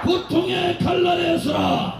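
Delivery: slow roared battle cry; roaring rallying speech. Real, not acted. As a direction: chant each line like a war cry. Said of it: "큰 목소리로 구호를 해주십시오".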